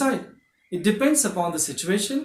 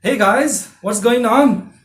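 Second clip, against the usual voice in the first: cheerful greeting; 4 semitones higher, 7 dB louder, slower than usual. Shown as a demonstration. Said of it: "you say".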